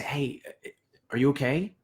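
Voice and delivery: soft, caring are you okay; gentle check-in. Shown as a demonstration. Leader softly checking on a bandmate, gentle. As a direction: ask quietly with real concern. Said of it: "But if I walk up to you and I say".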